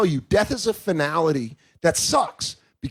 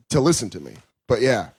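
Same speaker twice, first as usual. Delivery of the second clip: ordinary talk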